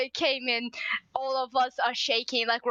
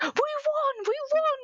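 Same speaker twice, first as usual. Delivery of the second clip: retold screaming; 12 semitones higher than usual. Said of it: "everybody started screaming".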